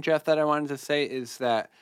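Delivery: ordinary talk, nothing marked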